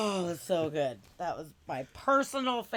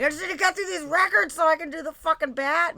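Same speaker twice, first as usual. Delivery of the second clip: mock eager; 9 semitones higher, 8 dB louder, faster than usual. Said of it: "I was like".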